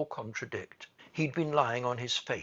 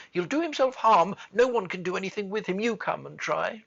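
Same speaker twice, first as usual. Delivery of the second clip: raised, urgent, rushing plea; great hurry; 7 semitones higher, 7 dB louder, faster than usual. A narrator's reading. Nurse begging the child for help, in a great hurry. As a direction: hurry the words out, higher and louder. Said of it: "in a great hurry".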